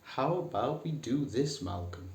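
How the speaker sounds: a hint of a prim office manager asking; mock-refined boardroom suggestion; posh boardroom speak. Shown as a demonstration. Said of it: "don't say".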